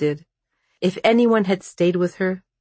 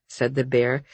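ordinary delivery

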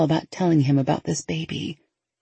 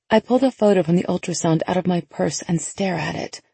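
ordinary delivery, nothing marked

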